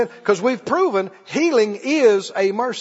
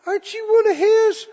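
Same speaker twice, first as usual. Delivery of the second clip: higher, pointed question; a higher-voiced accuser; accusing bystander; 11 semitones higher than usual. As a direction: corner him with the question. Servant accuses Peter by fire.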